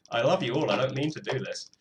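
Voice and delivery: ordinary talk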